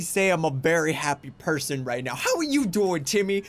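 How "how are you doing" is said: ordinary delivery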